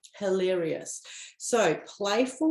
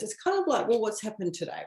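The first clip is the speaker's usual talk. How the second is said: ordinary delivery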